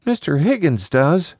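put-on high-pitched small boy; high child voice; little child. A narrator's reading. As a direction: answer like an uneasy young child.

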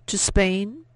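high, loud rush of hope; eager surprise. A narrator's reading. Woman asks the guide if he'll really take them, eager. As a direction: jump at the offer, thrilled.

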